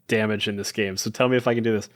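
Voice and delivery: ordinary talk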